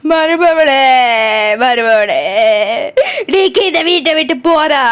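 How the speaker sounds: low, drawn-out lament; hammed-up weeping. Aunt lamenting the departing daughter-in-law, mock weeping. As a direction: wail theatrically over her leaving. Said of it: "ஏத்தே, ஏன்தே அழுதுட்டு இருக்கியோ".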